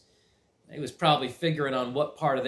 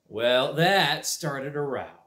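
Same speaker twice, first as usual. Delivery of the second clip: usual speaking voice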